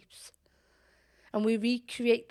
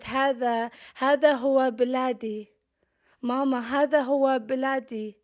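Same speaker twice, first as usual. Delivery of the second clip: louder, higher, fast Arabic cry; proud declaration; 3 semitones higher, 5 dB louder, faster than usual. Retold or imitated. Child declaring her homeland to mama in Arabic. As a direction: fling the words out with pride. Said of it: "in my broken Arabic, I said".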